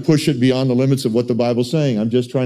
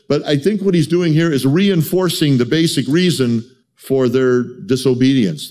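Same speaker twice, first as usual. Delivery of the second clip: ordinary talk, nothing marked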